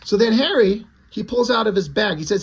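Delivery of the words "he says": plain reading voice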